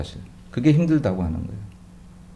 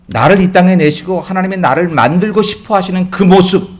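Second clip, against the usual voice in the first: loud, high preaching climax; fiery preaching; 7 semitones higher, 6 dB louder than usual. Acted. Pastor rousing the congregation with a loud, high sermon climax. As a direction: preach it to the congregation at full force.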